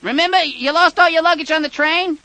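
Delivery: high, loud overeager caller voice; excited prank caller. Acted. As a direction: insist you're old friends, loudly. Prank caller insisting he knows victim.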